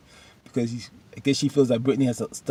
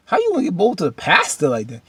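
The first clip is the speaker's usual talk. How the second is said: mock scolding; 7 semitones higher, 6 dB louder than usual. Husband scolding wife in quote.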